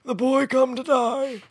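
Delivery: villain voice. Retold or imitated. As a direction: hiss it out like a dark wizard. Mock Harry Potter villain line.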